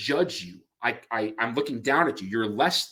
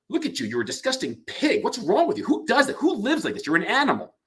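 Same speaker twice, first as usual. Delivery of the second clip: scornful, rising higher in disgust; contemptuous; 8 semitones higher than usual. Shown as a demonstration. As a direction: sneer down at them, higher on each question. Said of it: "Contempt might be".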